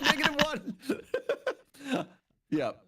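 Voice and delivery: slow, high laughing repeat. Real, not acted. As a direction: laugh through it. Laughing self-mockery.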